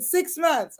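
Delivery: high-pitched mock pronouncement; retold professor's verdict. Professor penalising a student's wordy topic, mock-stern high pitch.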